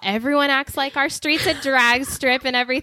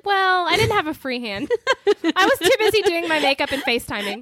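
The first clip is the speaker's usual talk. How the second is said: high playful confession; sheepish excuse; 6 semitones higher than usual. Retold or imitated. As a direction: joke it out in a high voice. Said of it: "And I was like".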